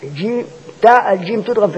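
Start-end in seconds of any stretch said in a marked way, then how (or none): none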